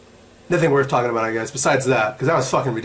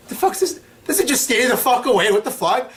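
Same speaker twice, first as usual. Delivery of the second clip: baffled onlooker; 9 semitones higher than usual. Acted. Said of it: "if you were someone from the outs".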